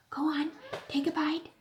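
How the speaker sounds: soft coaxing whisper. Acted. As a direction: whisper encouragement. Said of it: "whispered in his ear".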